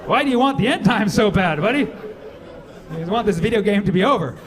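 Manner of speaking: alarmed confrontation. Retold or imitated.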